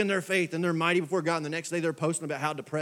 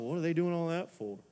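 judgmental churchgoer; 2 semitones lower than usual. Retold or imitated. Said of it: "if you're giving somebody a stink eye".

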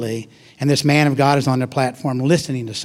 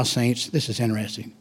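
ordinary talk

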